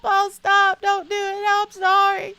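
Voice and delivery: high, loud hammed-up pleading wail; mock screaming for mercy as if being whipped; fake holler. Child fakes cries while papa belts his shoe, loud mock wail. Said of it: "you better holler like I'm killing you".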